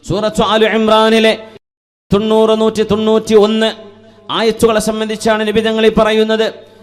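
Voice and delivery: loud, high-pitched sermon delivery; impassioned preaching. Real, not acted. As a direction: preach it out in ringing, sustained phrases. Preaches to congregation.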